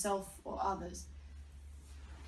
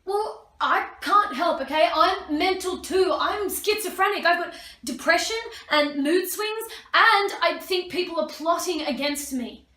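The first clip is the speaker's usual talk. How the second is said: put-on angry teen shouting; 7 semitones higher, 10 dB louder than usual. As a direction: scream it like a furious teenager. Shouted teenage quarrel with siblings.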